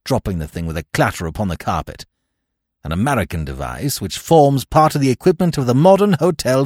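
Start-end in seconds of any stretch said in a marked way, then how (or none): 2.8-6.7 loud, higher, snappish explanation; snapped. A narrator's reading. Smith explaining the ladder to his friend, snapped. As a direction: snap it out with force. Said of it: "snapped my friend".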